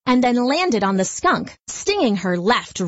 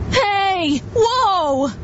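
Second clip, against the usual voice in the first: much higher startled yelp; startled cry; 10 semitones higher than usual. Acted. Bee tumbling in the wind, a high startled cry. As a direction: swept off by a sudden wind. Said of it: "he cried".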